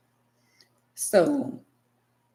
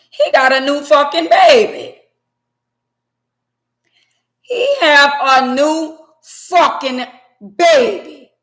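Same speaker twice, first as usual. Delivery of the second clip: loud, higher cry of disbelief with a break; outraged; 8 semitones higher, 11 dB louder, slower than usual. Real, not acted. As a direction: wail it out in disbelief. Outraged rant about the baby.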